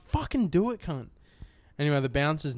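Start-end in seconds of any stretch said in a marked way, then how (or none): 0.1-1.1 raised-pitch mock challenge; imitating a cocky provocation; retold goading dare. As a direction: dare him, pitch jumping up. Retold dare to a threatening man.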